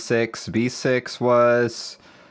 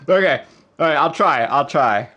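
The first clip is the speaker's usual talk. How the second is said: ordinary talk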